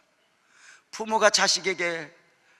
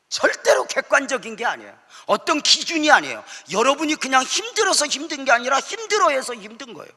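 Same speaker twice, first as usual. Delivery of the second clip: louder, higher, pressing each point home; preaching at full force; 9 semitones higher, 7 dB louder than usual. Real, not acted. Pastor preaches to the congregation at full force.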